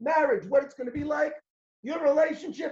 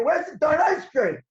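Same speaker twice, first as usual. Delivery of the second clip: frustrated; 5 semitones higher, 5 dB louder than usual. Acted. Upset shopper.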